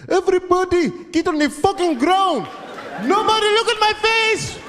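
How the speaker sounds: high, loud hostage-taker yell; screaming hijacker. Acted. Hijacker ordering a plane's passengers down, screaming. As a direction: bark frantic orders in a high-pitched scream. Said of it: "He was screaming and crying".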